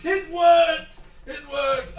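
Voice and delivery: loud, high laughing triumph; thrilled that the trick worked; excited cheer. Real, not acted. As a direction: celebrate it with delight. GM reacting to the spell.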